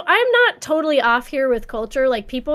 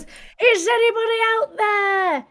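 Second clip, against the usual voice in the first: British lifeboat caller; 8 semitones higher than usual. Retold or imitated. Retelling the lifeboat scene, very high British-accented call. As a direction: search the freezing water with your voice. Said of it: "it's the accent makes it fun".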